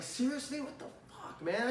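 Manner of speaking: unvoiced, muttered-to-yourself swearing; inner whisper. Rep silently fuming to himself after the wasted pitch, whispered. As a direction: whisper it as private thought. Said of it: "in your head".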